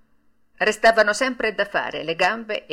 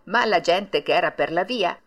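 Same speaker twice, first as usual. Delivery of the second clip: usual speaking voice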